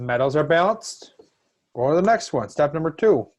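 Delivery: loud, rising teacher call; shouting. Real, not acted. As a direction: push the next step out loudly to the class. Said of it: "Sorry, I'm yelling at you with these steps".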